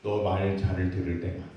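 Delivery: low, dry tag-on; conditional punchline. Retold or imitated. Pastor quoting an ironic ad about conditional parental love. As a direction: drop it deadpan as the catch. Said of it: "그 뒷말이".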